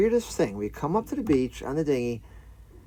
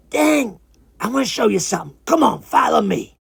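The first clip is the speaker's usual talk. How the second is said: loud, pitched-up sly creature voice; sly iguana; 5 semitones higher, 7 dB louder than usual. Acted. Iguana luring the visitor up the beach, sly high voice. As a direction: beckon like a scheming little iguana. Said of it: "sly little iguana smile".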